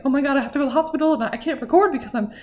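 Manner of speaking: fast, breathless retold panic; re-living the panic of rushing to the hospital; panicked. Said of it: "I was so, like, jittery and antsy".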